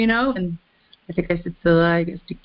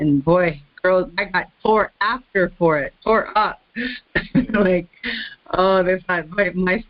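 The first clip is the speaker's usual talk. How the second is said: ordinary delivery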